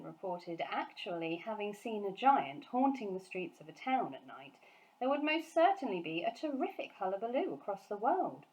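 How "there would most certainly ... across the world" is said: ordinary delivery, nothing marked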